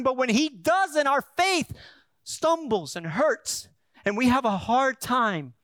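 loud, high, driving sermon voice; preaching at full force. Acted. Pastor preaching hard to the congregation.

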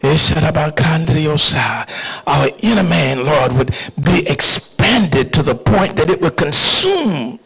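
impassioned pulpit prayer, swelling then settling; fervent prayer. Real, not acted. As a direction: plead with God on the congregation's behalf. Leads the congregation in prayer.

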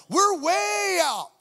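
high loud shout, stretched out slowly. Retold or imitated. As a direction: drag each word out while shouting. Panicked inner cry at the meeting.

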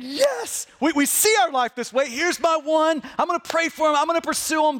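high-pitched, enthusiastic yes; eager resolve. Acted. Congregation saying yes to seeing life this way, eager. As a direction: act out keen, wholehearted agreement.

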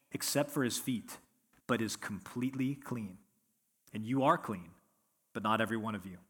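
soft, steady reading of the gospel text; scripture reading. Acted. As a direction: read the passage plainly and reverently. Scripture reading before the sermon.

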